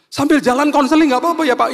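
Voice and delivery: high, wailing imitation of a tearful man; mock crying. Driver begs the pastor for counseling, hammed-up tearful wail. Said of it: "Terus dia tiba-tiba nangis".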